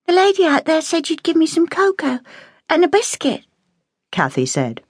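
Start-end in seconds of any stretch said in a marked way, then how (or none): 0.1-3.4 put-on higher, small child voice; little girl. A narrator's reading. Girl telling the cook why she came, timidly. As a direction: ask timidly for the cocoa.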